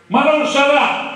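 high, slow and loud rally speech; rally orator. Retold or imitated. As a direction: lay out the enemies to the crowd. Capanna addresses the assembly. (oratore da assemblea, lento e forte)

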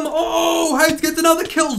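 high, loud victory shout; gloating cheer. Real, not acted. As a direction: crow over the kill. Cheering own kill.

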